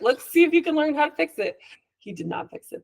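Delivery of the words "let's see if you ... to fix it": higher, playful, encouraging tone; lightly mimicked upbeat parent voice. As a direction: cheer the kid on to give it a try. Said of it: "I'm like".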